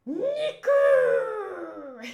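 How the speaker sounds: loud, high shout for meat. Acted. Luffy in the manga craving meat, yelling loudly. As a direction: yell it like starving Luffy.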